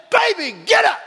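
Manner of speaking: high, loud call to a dog; retold shout. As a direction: holler at the dog to get up.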